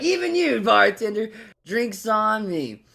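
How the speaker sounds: high, happy call easing down; gleeful invitation to the bartender; happy generous announcement. Acted. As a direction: start high and grand, stretch the last words. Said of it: "all happy and all that stuff".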